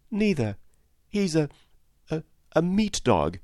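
put-on high, hesitant child's answer; girl's voice. A narrator's reading. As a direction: search for the word in a little-girl voice. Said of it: "said the girl".

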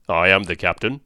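a hint of a growling sea captain. A narrator's reading. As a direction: growl it out like an old sea-wolf. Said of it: "and a growling voice".

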